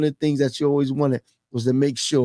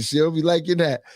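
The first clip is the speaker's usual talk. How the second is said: ordinary talk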